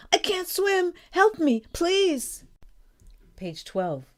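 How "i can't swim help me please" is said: high, loud desperate plea; panicked shouting. A narrator's reading. Small boy who can't swim, panicked shouting. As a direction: cry out high and loud for rescue. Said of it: "he is shouting".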